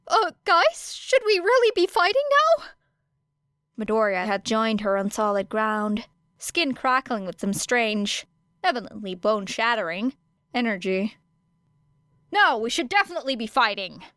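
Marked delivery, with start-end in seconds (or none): 0.1-2.6 much higher, louder anxious pleading; a worried boy begging them to stop; nervous protest. A narrator's reading. Nervous boy interrupts a fight. 12.3-14.0 curt. A narrator's reading. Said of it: "said Era shortly".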